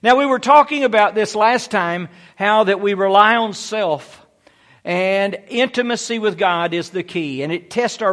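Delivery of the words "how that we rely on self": normal talking voice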